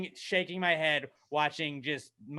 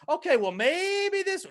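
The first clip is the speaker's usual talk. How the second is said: high, slow drawn-out wishfulness; naive hope; 14 semitones higher, slower than usual. Retold or imitated. Fan buying in once more, mock hopeful. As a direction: stretch it into a squeaky maybe.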